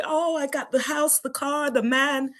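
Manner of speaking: high, gushing boast of blessings; mock celebrating. Churchgoer celebrating the house, car and man, high mock gush. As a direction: parody a believer showing off what they got. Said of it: "We, we, we celebrate".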